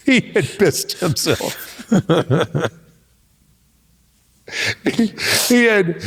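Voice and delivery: slow words through real laughter; can barely get it out for laughing. Real, not acted. Teller recounting friend's accident, laughing hard.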